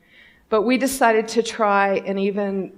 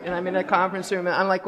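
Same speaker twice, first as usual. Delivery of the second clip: normal talking voice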